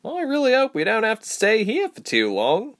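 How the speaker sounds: high-pitched, shuddering dismay; a hint of recoil, pitched high; mild shudder. A narrator's reading. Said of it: "said with a shudder".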